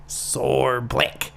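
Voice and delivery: mock Australian, broad vowels; exaggerated Australian accent; shitty Australian accent. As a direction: put on a hammed-up Aussie accent. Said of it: "shitty Australian accent".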